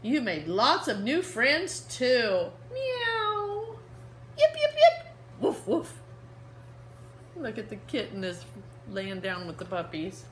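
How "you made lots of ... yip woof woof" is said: slow fond line, then high meows and yips; fond girl and animals. Acted. Reading to kids, the girl and animals close the story. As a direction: end sweetly with the animal noises. Closing page with animal sounds.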